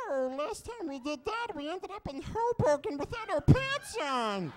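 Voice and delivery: very high, wailing creature falsetto; hammed-up timid creature, pleading; Schmiegel. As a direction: whine in protest, drawn out. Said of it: "a nice impression of Schmiegel".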